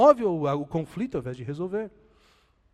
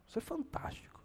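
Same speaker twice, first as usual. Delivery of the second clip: an awed, breathy whisper; whispered awe; 11 semitones higher, 12 dB quieter than usual. Real, not acted. Preacher marvels to the congregation in a hushed whisper. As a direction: hush it like a secret wonder.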